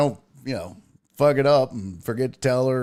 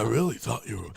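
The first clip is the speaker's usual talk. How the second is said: soft, low murmur of disbelief; imitated whisper; 3 semitones lower, 7 dB quieter, faster than usual. A fan admitting a mistake to him, hushed. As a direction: confide it under your breath. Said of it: "they would whisper it".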